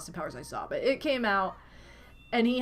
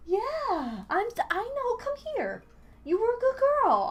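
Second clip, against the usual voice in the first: high sing-song pet voice, much higher; 12 semitones higher than usual. Real, not acted. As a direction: coo up high like talking to a kitten. Baby talk to cat.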